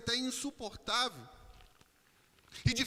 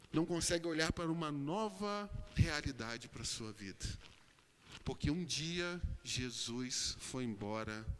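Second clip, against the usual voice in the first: low, weighty preaching; pregação solene; 5 semitones lower than usual. Acted. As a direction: lower the voice and let each phrase sink in. Solemn low preaching passage. (pregação grave e solene)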